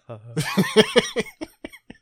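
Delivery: a loud laugh bursts out; sudden burst of laughter. Real, not acted. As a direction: break into helpless laughter. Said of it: "trying to have quiet laughs".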